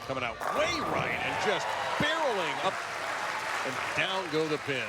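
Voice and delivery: rising excited strike call; excited play call. Real, not acted.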